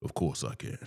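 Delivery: low, obliging character voice; smooth, low and saucy reply; saucy deep voice. Acted. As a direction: drop low, playing along saucily. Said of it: "like, saucy".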